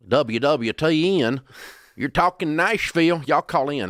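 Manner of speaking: high, loud hammed-up hillbilly radio twang; mock hillbilly twang on a call-in show; heavy hillbilly twang. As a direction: play a hokey hillbilly radio host with a thick twang. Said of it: "in a really heavy hillbilly twang".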